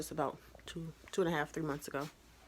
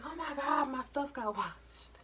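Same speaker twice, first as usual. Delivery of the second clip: higher, drawn-out mock dismay; 8 semitones higher, slower than usual. Vlogger acting out finding pocket stuff washed, mock dismay. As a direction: imitate your own dismay, dragging out the end. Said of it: "Be looking crazy when I be like".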